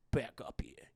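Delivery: breathy whispered alarm. Retold or imitated. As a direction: drop to a startled whisper. Repeated line, hushed.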